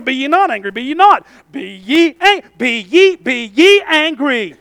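stumbling mock reread of "Be ye angry", slowing down; mock his own double take over the verse; disbelieving reread. Preacher acting out rereading the verse in disbelief. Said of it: "I'm sitting there".

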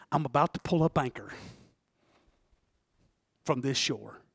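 real, hushed awe; overcome, hushed. Real, not acted. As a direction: voice sinks to near silence, overwhelmed. Preacher overcome, voice sinking very low.

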